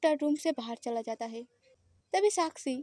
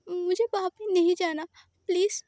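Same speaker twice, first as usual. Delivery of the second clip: crying; 5 semitones higher than usual. Acted. Scared boy begs not to be taken home, crying. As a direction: beg through tears. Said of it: "रोते हुए".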